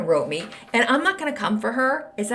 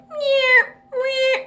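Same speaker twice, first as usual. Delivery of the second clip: high squeaky cat meows; mock meowing; 5 dB louder than usual. Podcaster's finger skipping ads, high cat meow.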